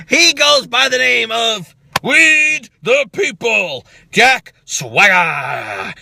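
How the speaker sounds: slow, drawn-out announcer intro; ring announcer. Acted. Vlogger introducing Jack Swagger like a ring announcer, drawn out. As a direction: announce him like a big entrance.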